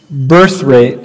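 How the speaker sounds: normal talking voice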